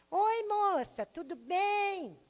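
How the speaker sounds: put-on high, slow little-girl voice. Preacher imitating a little girl's voice on a scam audio. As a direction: imitate a seven-year-old girl on a voice message. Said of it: "Bem com a vozinha assim mesmo".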